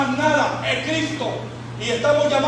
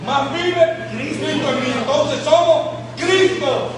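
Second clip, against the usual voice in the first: slow emphatic preaching; 4 semitones higher, 4 dB louder, much slower than usual. Real, not acted. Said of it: "preaching emphasis, slowed".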